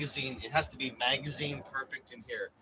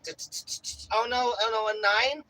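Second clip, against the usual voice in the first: very high, louder frantic wail; mock panic; 11 semitones higher, 3 dB louder than usual. Dialing during a break-in, high hammed-up panic. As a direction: squeal out each number in hammed-up terror. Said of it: "You're like".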